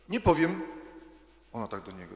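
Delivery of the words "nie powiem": put-on defiant little girl. A narrator's reading. Child refuses, high defiant voice.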